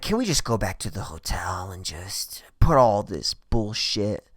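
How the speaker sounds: soft, low, whispery plea; hushed coaxing. Retold or imitated. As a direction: coax them in a near whisper. Retold sweet-talking plea to the woman.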